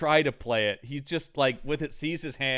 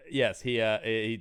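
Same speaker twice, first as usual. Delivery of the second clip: usual speaking voice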